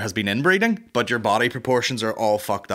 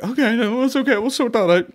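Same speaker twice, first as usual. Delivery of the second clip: panicky high voice; 13 semitones higher, 5 dB louder than usual. Retold or imitated. Imagined scared hotel worker.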